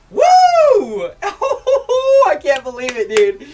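high whoop melting into laughter; laughing whoop. Real, not acted. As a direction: laugh through your disbelief. Streamer giddy over the shiny.

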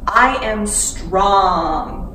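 slow, loud affirmation; loud and powerful. Shown as a demonstration. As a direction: proclaim it for the kids to repeat. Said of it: "loud and powerful".

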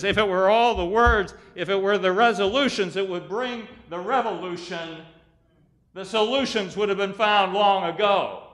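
loud, high, driving sermon delivery; preaching at full force. Real, not acted. Preacher urging congregation beyond words to living, preaching at full force. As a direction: build it into a ringing pulpit cry.

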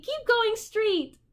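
a teasing high echo of the advice. A narrator's reading. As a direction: draw the words out, luring. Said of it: "counseled the voice".

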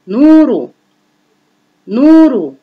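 long "oo" in نور, pitched high and loud; مد الواو. Shown as a demonstration. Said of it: "أين يوجد مد الواو". (مد الواو في «نور» بصوت عالٍ ممدود)

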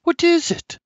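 high, mother asking; mother's voice. A narrator's reading. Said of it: "me ma asks".

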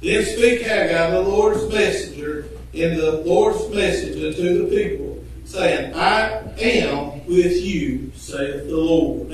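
low, slow solemn reading of scripture. Acted. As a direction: read the verse softly, weighing each word. Reads scripture aloud.

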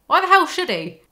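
outraged rhetorical question; indignant outburst. Real, not acted. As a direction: throw the question back in outrage.

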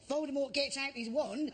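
ordinary talk

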